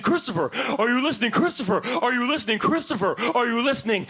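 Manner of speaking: repeated stern classroom callout; teacher calling out. Acted. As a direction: call the daydreaming kid back. Teacher calls distracted pupil.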